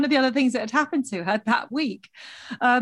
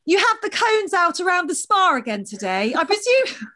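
high, carrying, pointed politeness; loud passive aggressive; 9 semitones higher, 3 dB louder than usual. Retold or imitated. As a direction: call out across the room sweetly. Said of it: "in a really loud voice".

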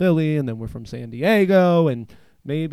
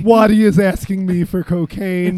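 high, loud, stretched shout of disbelief; starstruck shriek; 7 semitones higher, 11 dB louder than usual. Retold or imitated. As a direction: shriek it like meeting your idol. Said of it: "And I'm like, gasps".

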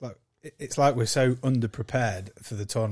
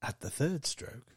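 mock speaking clock announcement; 3 semitones higher, 4 dB quieter than usual. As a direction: read it like the old phone time line. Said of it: "It's a fucking talking clock".

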